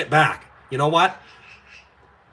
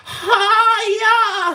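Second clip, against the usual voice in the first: much higher, loud held mock battle cry; karate yell; 5 dB louder than usual. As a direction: belt out a cartoon kung-fu shout. Schwab snapping on the gauntlet.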